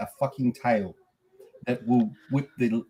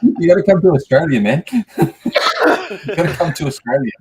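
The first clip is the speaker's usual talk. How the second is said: excited; 4 semitones higher, 10 dB louder, faster than usual. Real, not acted. Excited loud invitation.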